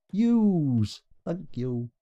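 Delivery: playing a game character lightly; mock voice acting. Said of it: "S tier voice actor".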